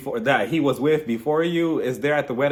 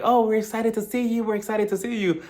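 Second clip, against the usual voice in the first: high-pitched imitated gushing; lightly mocking eager texters; mock excitement; 7 semitones higher than usual. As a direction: gush in a lifted singsong. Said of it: "text messages like".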